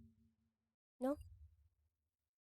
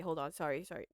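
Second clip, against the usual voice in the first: normal talking voice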